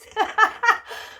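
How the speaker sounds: high breathy burst of laughter. Real, not acted. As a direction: laugh out helplessly. Laughing fit at food.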